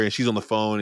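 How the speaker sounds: usual speaking voice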